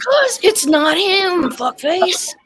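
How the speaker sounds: high, loud, drawn-out retort; exasperated shout. Real, not acted. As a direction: yell it at your friend in disbelief. Heated argument about Nick Fury.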